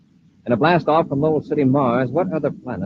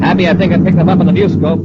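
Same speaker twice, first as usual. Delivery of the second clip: louder, higher, eager announcement; excited call; 7 semitones higher, 8 dB louder than usual. Acted. Commander calling Happy over to the view scope, excited. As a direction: call out the find with a jolt of excitement.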